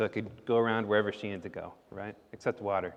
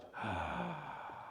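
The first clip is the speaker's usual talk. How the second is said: surprised gasp; 10 semitones lower, 11 dB quieter than usual. A narrator's reading. Sasha gasps in surprise.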